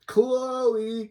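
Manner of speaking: high, stretched-out name; drawn-out name call. Acted. Recapping the story's next character, high sing-out.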